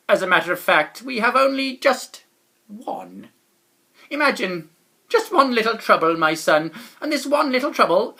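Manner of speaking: loud, fussy lament over the trouble; doorman's lament. A narrator's reading. Doorman explaining the locked door to the traveller, fussy lament. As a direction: complain about the slipard.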